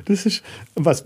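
high amused laugh, then slow words; delighted laugh. Real, not acted. Laughs at question.

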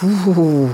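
long hooting whoosh of a whirlwind; imitated howling wind. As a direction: howl like the wind. Erzählerin ahmt den Wirbelwind nach. (nachgeahmtes Windheulen)

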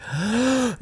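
high startled gasp; sharp gasp. Real, not acted. Narrator gasps as it clicks. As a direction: gasp of realization. Sudden realization.

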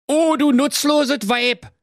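high, scolding Berlin husband voice; grumpy Berlin husband. Acted. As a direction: nag her from the armchair. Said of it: "sprach da der Werner". (hoch gepresster, schimpfender Berliner Ehemann)

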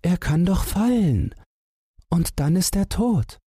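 high squeaky mouse voice, fretting; a tiny mouse afraid for the other; high anxious mouse voice; worried little mouse. A narrator's reading. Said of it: "fragte Wackelohr".

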